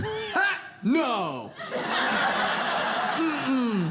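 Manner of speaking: a sharp mock laugh then a flat no; scoffing cashier. Cashier refusing the wine, a mocking ha then no. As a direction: cashier turning her down with a scoffing ha. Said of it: "and she goes".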